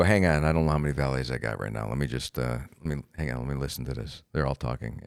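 low, quiet, distracted mumble; muttering while listening to a radio; busy valet attendant.

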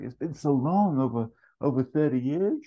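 high, gushing disbelief; amazed reunion. Acted. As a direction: lift the pitch in happy amazement. Howard reunited with old flame.

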